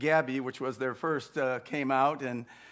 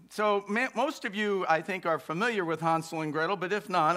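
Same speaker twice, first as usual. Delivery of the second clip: ordinary delivery, nothing marked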